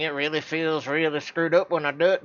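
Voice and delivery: ordinary delivery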